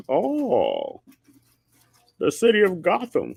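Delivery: hammed-up imitation of Bane's odd accent; mocking Bane accent. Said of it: "So why are you talking like this?".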